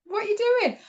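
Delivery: high-pitched mock bafflement; squeaky-high retold question; baffled partner.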